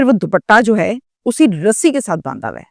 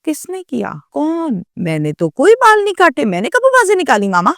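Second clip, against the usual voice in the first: put-on innocent child denial; 7 semitones higher than usual. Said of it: "کہتی".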